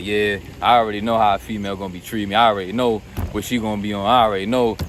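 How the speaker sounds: lightly mocked cocky dismissal; smug know-it-all. Mocking know-it-all guy.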